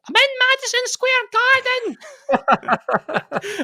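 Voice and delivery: high, slow, distant muffled shout; muffled phone voice. Retold or imitated. Mum shouting down the phone from the Garden, high and muffled. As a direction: mimic a drunk shout down a bad phone line. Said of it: "the very muffled".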